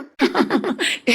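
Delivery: amused chuckling burst; laugh. Acted. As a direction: laugh along at a family joke. Laughs at relative's joking remark. (சிரிப்பு)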